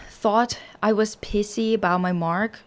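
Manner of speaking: usual speaking voice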